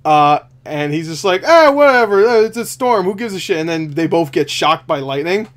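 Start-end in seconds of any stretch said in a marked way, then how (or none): 1.4-3.6 high, loud start easing down; mock dismissive. Henry Cavill's character waving off a storm, mock dismissive. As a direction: brush it off with careless scorn.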